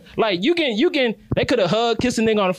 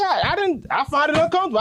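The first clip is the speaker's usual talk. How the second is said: usual speaking voice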